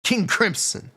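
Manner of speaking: usual speaking voice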